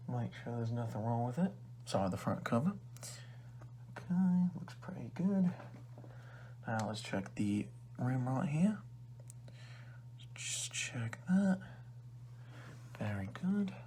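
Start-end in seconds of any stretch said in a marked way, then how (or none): 4.0-5.5 whispering. Acted. 10.3-13.7 slow, high whisper. Acted. Whispering while inspecting.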